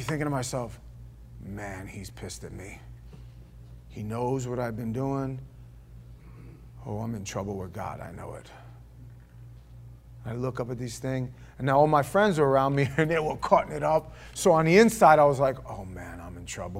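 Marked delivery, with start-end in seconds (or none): 1.4-8.5 hushed inner thought. Retold or imitated. Quoted inner fear, hushed. 15.7-16.8 hushed worry. Retold or imitated. Young churchgoer quietly worrying to himself. Inner worried thought, hushed.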